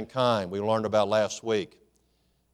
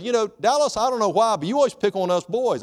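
complaining men; 9 semitones higher, 3 dB louder than usual. Retold or imitated.

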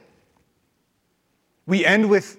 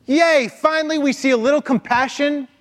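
high mock celebration, tongue in cheek; a sarcastic little hooray, pitched high; sarcastic cheer; 8 semitones higher, 4 dB louder than usual. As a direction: cheer it with a wink. Mock cheer for Jonah's compassion.

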